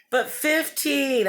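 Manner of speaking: high, drawn-out disbelief; shocked. Real, not acted. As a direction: stretch the number out in shock. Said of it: "I'm kind of in shock".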